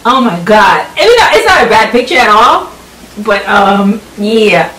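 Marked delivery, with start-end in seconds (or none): none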